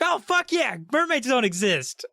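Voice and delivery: very high, squealed celebration; mock overjoyed boy. Some boy cheering weirdly at the news, mock overjoyed squeal. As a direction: whoop it like an absurdly thrilled boy. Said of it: "It's like some boy would be like".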